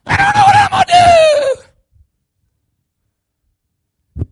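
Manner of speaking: very high, loud panicked wail; hammed-up cry of helplessness; mock panic. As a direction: wail to the preacher as if all is lost. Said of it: "but the least little bump in the road".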